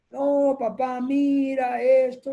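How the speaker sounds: high, drawn-out pleading with dad; hijo negociando. Acted. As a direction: whine out a bargain as the son. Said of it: "está negociando". (súplica aguda y alargada)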